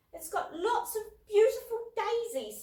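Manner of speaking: put-on high, slow delighted little girl. A narrator's reading. Little sister showing Dad her daisies, high and slow. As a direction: coo it like a small child in a meadow.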